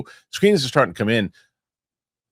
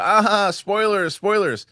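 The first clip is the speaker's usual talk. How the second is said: playful; 8 semitones higher, much slower than usual. Retold or imitated. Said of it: "and I was playful".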